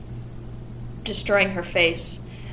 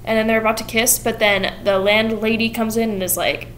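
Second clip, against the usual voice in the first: ordinary delivery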